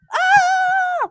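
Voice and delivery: loud shrill mock scream; high scream. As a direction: scream as he falls. Scream as Paul falls.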